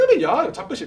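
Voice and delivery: high, sharp rural scold; mock scolding. Deacon scolding the children in Jeolla dialect. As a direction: shoo the kids like a gruff country deacon.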